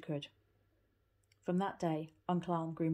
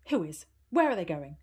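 anxious, much louder questions; 9 dB louder than usual. Acted. Granny asking about Heidi, anxious and loud. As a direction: fear she already knows the answer. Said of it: "asked Granny anxiously".

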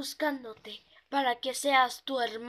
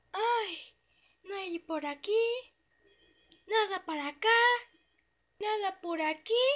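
a little pony chattering nervously alone at night; high, light toy voice peering into the dark; 6 semitones higher than usual. Acted. The pony talks to herself walking the empty city, high and timid.

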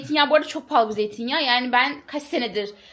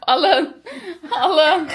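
laughing; 5 semitones higher, 5 dB louder than usual. Real, not acted. Handing over a forgotten bag.

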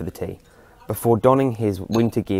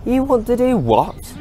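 much higher, louder, slow startled disbelief; can't believe what he just heard; surprised exclamation; 5 dB louder, slower than usual. Acted. Jack stunned by the old man's plan to go out tonight, shocked. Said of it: "exclaimed Jack ... in surprise".

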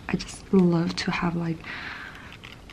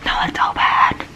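whisper; 4 dB louder than usual. Real, not acted. Whispers throughout unboxing.

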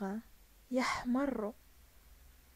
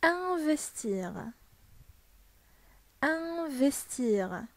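slow model word; 3 semitones higher, much slower than usual. Shown as a demonstration. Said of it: "يعني يستثمر".

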